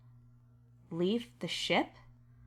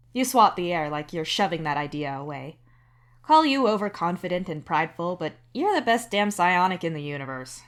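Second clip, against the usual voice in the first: plain reading voice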